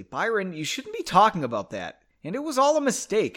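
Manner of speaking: mildly panicked, pitch climbing at the end. A narrator's reading. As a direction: protest with rising alarm. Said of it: "she said in a panic".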